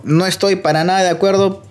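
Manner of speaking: ordinary talk, nothing marked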